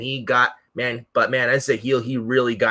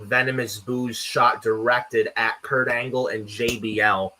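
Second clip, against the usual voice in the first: normal talking voice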